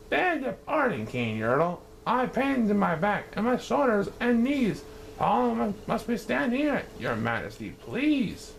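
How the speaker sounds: high, timid pleading; humble plea. Acted. Mac pleading with the king from the bottom, high and timid. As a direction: beg politely from below.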